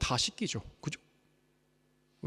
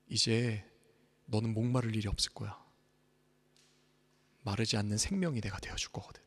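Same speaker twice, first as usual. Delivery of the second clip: softer, lower, fading to a whisper; tender voice of Jesus; 4 semitones lower, 4 dB quieter than usual. Acted. Jesus speaking to believers, tender and hushed. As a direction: offer it gently and intimately. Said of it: "말씀하신다고요".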